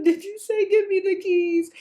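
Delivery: high, loud, hammed-up tearful question; mock ugly-cry wail. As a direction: wail it like a retold sobbing fit. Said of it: "I had an ugly cry".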